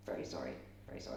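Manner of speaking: hushed, rushed, whispered apology; apologetic whisper. Retold or imitated. Messenger backing out of the room, hushed hurried apology. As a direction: whisper the sorry fast as you back off.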